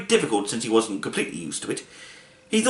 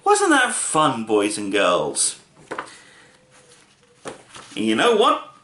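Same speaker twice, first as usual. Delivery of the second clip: slow, singsong storyteller aside; reading to children; 5 semitones lower, slower than usual. Acted. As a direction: ask the kids, slowly. Reader addresses children.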